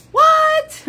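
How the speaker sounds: a sudden high-pitched yelp of surprise; squeaky surprised what. Real, not acted. Reacts to her own joke.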